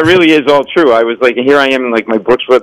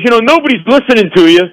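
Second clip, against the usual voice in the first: mock drunk heckler; 10 semitones higher, faster than usual. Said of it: "He goes".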